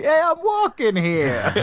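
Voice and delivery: high, drawn-out cartoon New Yorker accent; exaggerated New York accent. Podcaster joking about overdone film dialogue, hammed-up New York accent. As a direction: belt out a cheesy movie-style New York accent. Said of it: "it's me doing a New York accent".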